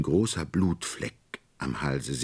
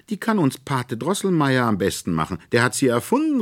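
put-on higher, louder eager boy; calling out; 10 semitones higher, 7 dB louder than usual. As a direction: call it out boyishly. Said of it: "rief Fritz".

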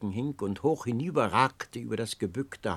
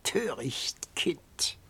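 put-on whispered old crone voice; lisping whisper; 4 semitones higher, 4 dB quieter, much slower than usual. A narrator's reading. Old beggar woman whispering to the wounded youth. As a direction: breathe it out like a crone. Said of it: "so lispelte dann die Alte".

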